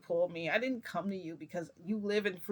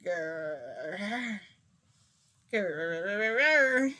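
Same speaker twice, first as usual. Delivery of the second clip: rolling mock growl, swelling louder; playful bear growl; 4 dB louder than usual. Wearing the bear hat instead of howling, playful growl. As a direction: let the pretend growl build. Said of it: "I got on the freaking bear hat".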